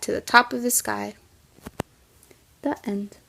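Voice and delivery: lively storybook read-aloud for a class; reading to children. Acted. Teacher reading the kite story to her class, in a lively storybook voice. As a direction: read it like story time for young kids.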